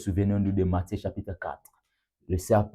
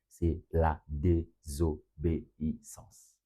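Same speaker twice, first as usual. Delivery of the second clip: "désobéissance" slowly split into separate syllables, softer; 4 semitones lower, 7 dB quieter, much slower than usual. Real, not acted. Preacher revealing his third point to the congregation, "désobéissance" syllable by syllable. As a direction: spell out "désobéissance" syllable by syllable, low and measured.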